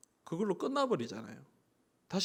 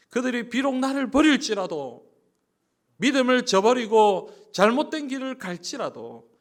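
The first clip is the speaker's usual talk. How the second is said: higher, louder preaching push; fervent preaching; 9 semitones higher, 9 dB louder than usual. Acted. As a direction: rouse the congregation with pathos. Preaching climax.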